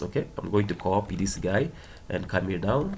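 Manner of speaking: usual speaking voice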